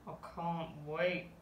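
a dad dreading the jog; sarcastic. A narrator's reading. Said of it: "said Mister Large".

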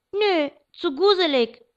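put-on high, slow small-child voice; a frightened toddler, hesitant; scared little boy. A narrator's reading. James, afraid after his nightmare, refuses the scary book in a small high voice.

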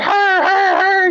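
put-on a crowd of children pointing and calling out; kids shouting. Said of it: "they'd be like".

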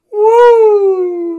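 shrill, very high, loud woo; Ric Flair woo. Retold or imitated. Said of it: "one last final woo".

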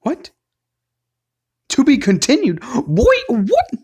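loud, high yell of disbelief; shocked outburst. Real, not acted. Player reading the cliffhanger screen, shocked outburst. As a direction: shout it in outraged astonishment.

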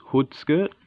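ordinary delivery

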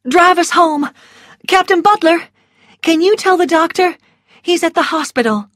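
high, urgent instructions; urgent request. A narrator's reading. Melanie asks Captain Butler to fetch the doctor, urgent.

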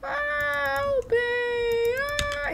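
very high, drawn-out wail; acted cry for help. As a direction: wail as a dying character begging. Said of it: "He's like".

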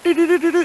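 high, loud "do, do, do" radar beeps; mock radar beeping. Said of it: "there's a Cockney that's like".

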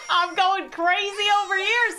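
high, giggly delivery; amused and losing it; laughing. Real, not acted. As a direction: say it through a rising laugh. Giggling at own game chaos.

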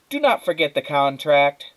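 loud, stress on not; mother shouting. A narrator's reading. Mother shouting at Flora, stressing not. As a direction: hammer the word not. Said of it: "her mother shouted".

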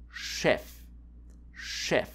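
"Chef" said as sheff; careful German "Chef", sh at the start; ch as sch. Shown as a demonstration. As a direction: show "Chef" starting with a sh sound. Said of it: "C H can be pronounced like S C H".

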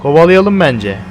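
normal talking voice

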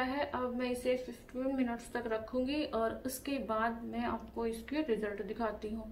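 speaking with covered lips; lip mask on lips. Real, not acted. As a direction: lips stiff under mask. Seen 1: Hydrogel lip mask covers both lips from application until peeled off.

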